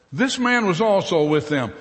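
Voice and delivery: loud, high pointed accusation; a servant girl's loud accusation; accusing. Acted. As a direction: call him out in front of everyone. Servant girl accuses Peter.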